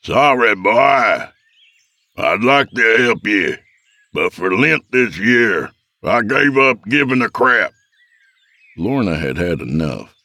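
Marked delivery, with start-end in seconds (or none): 0.0-7.7 put-on higher, called-out old man's voice; gruffly dismissive father. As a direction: call the refusal out to the boy.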